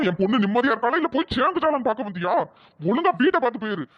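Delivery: normal talking voice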